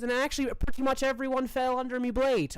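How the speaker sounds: high-pitched boasting fighter; boastful Annabelle. Acted. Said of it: "and she goes".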